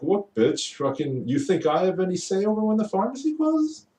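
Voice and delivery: high, mocking snap back at a rude customer; imagine finally telling the customer off; exasperated retort. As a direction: pitch shoots up in disbelief as the question builds. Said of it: "and I'm like".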